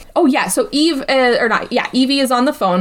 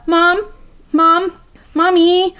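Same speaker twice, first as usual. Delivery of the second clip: put-on needy child calling; 7 semitones higher, much slower than usual. A kid in need calling for mom, higher. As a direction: call out like a kid who needs her. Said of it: "You could be like a".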